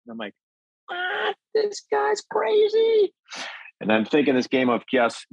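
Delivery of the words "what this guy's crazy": high, slow, squeaky mock disbelief; hammed-up high voice of disbelief. Retold disbelief at rival.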